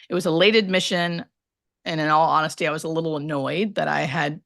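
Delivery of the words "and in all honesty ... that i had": normal talking voice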